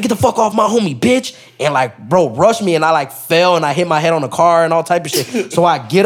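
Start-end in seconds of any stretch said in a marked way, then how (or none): 0.0-1.3 high-pitched mock aggression; retold angry warning. Men rush him to protect their homie, imitated anger. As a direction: act out warning someone off, pitched way up. Retold shout while rushed in fight.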